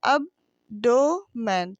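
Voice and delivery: abdomen very slowly in three separate beats, ab, do, men; split into syllables. Shown as a demonstration. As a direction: pull abdomen apart, a gap after each syllable. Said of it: "तीन हो गए". (abdomen के तीनों syllable अलग-अलग, बहुत धीरे)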